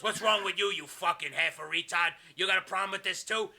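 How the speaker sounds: acted tough-guy fury; angry confrontation. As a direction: build to a shouted dismissal. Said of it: "And he goes".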